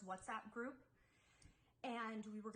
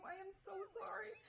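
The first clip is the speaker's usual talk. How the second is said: breaking into a whispered sorry; tearful whisper; 9 semitones higher than usual. Real, not acted. Apologizing to fans while starting to cry. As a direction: voice cracking as tears come. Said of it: "I fucking hate to cry".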